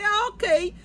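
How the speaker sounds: high-pitched mock acknowledgement; imitated shopkeeper. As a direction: mimic a vendor catching on. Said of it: "Aí ele".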